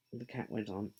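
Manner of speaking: usual speaking voice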